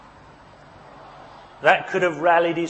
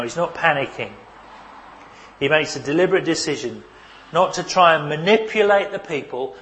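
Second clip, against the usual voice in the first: ordinary talk, nothing marked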